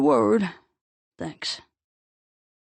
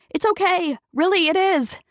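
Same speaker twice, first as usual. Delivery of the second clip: much higher, louder reassuring cry; shouted reassurance; 10 semitones higher, 6 dB louder than usual. A narrator's reading. Octavia shouting to Mitch that she is fine. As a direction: shout to calm him down. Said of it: "she shouted".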